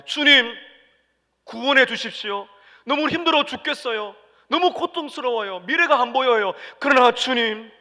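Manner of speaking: loud, high pleading cry to the Lord; desperate prayer. Acted. Disciples crying to the Lord in the storm, loud desperate plea. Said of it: "우리는 제자들처럼 이건 해야 됩니다".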